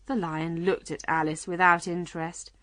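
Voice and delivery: usual speaking voice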